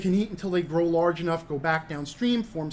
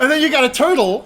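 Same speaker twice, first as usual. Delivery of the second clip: laughing; 8 semitones higher, 11 dB louder, faster than usual. Real, not acted. Amused listing of Pokemon.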